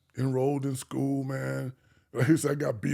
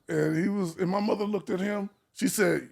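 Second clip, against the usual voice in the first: usual speaking voice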